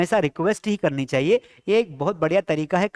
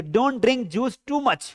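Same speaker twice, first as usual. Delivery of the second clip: very slow, word by word, for the class; slow model sentence; 3 semitones higher, much slower than usual. Shown as a demonstration. Teacher dictating an English sentence to students, very slowly. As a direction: spell each English word out slowly for learners. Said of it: "ज्यादा juice मत पियो".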